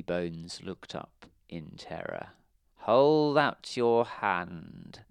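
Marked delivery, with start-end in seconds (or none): 2.8-5.0 slow, louder command; menacing blind man. A narrator's reading. Blind man commanding Billy Bones coldly. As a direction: hand over the doom. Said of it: "said the blind man".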